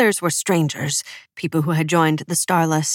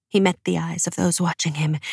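ordinary delivery

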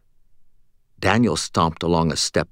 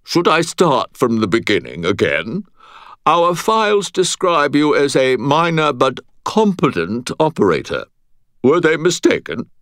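higher, haughty British nobleman; condescending British accent; 7 semitones higher, 4 dB louder than usual. A narrator's reading. Butters questioning Aristides after a sigh, condescending British accent. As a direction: talk down to him in obnoxious British. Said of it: "and then sighed".

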